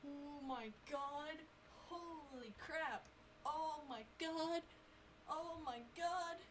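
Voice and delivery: stunned disbelief. Real, not acted. Player stunned by the game's reveal, drawn-out disbelief. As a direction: draw each word out softly, in awe.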